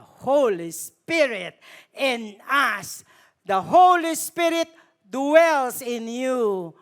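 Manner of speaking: loud, slow, rising preaching; fervent blessing. Real, not acted. Preacher pronounces the Holy Spirit's blessing over the congregation, loud and slow. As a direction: proclaim the Spirit over them with full force.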